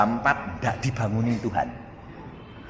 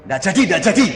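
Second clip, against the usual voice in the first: high, louder repeated call-off; abrupt cancellation; 9 semitones higher, 5 dB louder, much faster than usual. Acted. Teacher suddenly cancels the test, high insistent call. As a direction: call it off suddenly, twice over.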